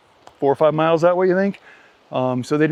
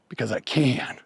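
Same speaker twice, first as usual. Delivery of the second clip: usual speaking voice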